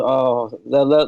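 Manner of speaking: usual speaking voice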